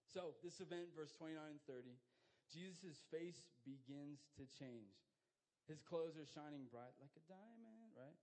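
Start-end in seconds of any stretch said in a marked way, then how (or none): none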